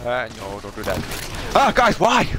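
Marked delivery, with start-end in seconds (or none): 1.5-2.3 higher, louder complaining cry; exasperated whine. Real, not acted. Gamer complaining to teammates, loud exasperated whine. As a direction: wail at teammates in frustration. Complaining about bad weapons.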